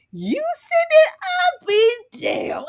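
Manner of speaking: high, slow, drawn-out disbelief; acted shock. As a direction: stretch it into an incredulous mock wail. Acting out a shocked reaction.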